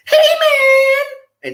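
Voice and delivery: very high, stretched caricature call. Shown as a demonstration. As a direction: squeal it out as a mock character.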